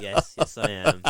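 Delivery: laughing through a mock-proud yes; laughing agreement; laughing reply. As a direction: answer proudly while cracking up. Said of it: "And I'm just like".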